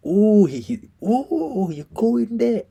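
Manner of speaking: high, drawn-out teasing "ooh"; hammed-up shocked realization, very high; mock scandalized reaction. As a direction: squeal it like the insult just landed. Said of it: "He's like".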